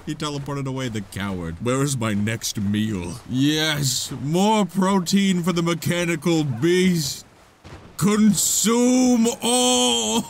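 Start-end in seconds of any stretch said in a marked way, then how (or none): 1.6-10.2 slow, loud hammed-up beast; mock monster voice. Voicing the mechanical beast craving its next meal, slow and loud. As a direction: growl it like a hungry machine.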